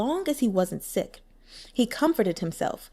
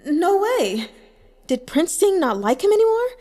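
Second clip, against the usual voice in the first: panicked; 8 semitones higher, 5 dB louder than usual. Acted. Fish panics at rejection.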